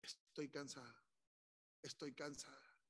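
soft, tired confession; hushed, weary admission; weary prayer. Acted. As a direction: say it quietly to God, worn out. Voices a tired believer's prayer.

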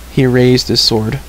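ordinary delivery

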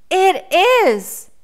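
put-on high, loud little-boy contradiction; insistent declaration. A narrator's reading. Boy contradicts the bear about the forest, insistent. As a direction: declare it firmly in a high child's voice. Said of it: "declared the boy".

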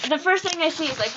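usual speaking voice

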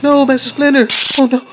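high frantic yelling after his falling master; panicked scream. Acted. Donnie screaming for Splinter as he falls, panicked. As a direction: shriek his name in panic.

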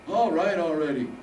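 put-on impatient old man. Harold impatient.

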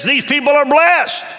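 high, loud declaration of blessing; preacher crescendo on blessed; rising proclamation. Acted. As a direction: build to a ringing shout. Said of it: "He said".

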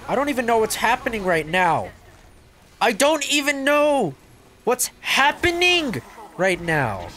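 high, loud, stretched-out disbelief; bewildered exclaiming. Real, not acted. Escalating bewildered repeat.

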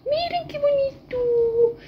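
very high, slow singsong; high drawn-out squeal of delight; delighted showing off. Real, not acted. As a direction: sing it out, proud of the cake. Showing off the finished cake.

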